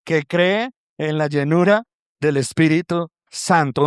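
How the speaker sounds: usual speaking voice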